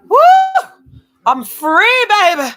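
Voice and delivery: loud, high whoop of relief; joyful shout. Real, not acted. Shouting with relief after taking off a hot layer. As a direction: let it burst out, free at last.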